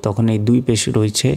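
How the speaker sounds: ordinary talk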